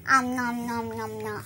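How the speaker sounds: playful pretend munching; nom nom chomping. Pretend eating toy food.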